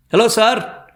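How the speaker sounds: high-pitched shouted greeting; high call to someone on the phone. Acted. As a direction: holler hello across the room. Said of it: "Vasanth இங்கிருந்து இறைந்தான்".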